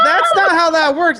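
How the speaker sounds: loud laughing protest; laughing shout. Real, not acted. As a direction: yell it through laughter. Laughing outburst at the hole.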